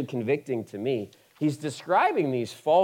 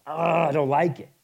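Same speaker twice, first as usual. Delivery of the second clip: grumbling complaint; 3 semitones higher than usual. Retold or imitated. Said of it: "it's rubbing".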